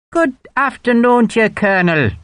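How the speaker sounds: high, hammed-up Irish lilt for Hogan; exaggerated Irish accent. A narrator's reading. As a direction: lay the Irish brogue on thick, mocking. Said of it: "deliberately exaggerating his Irish accent".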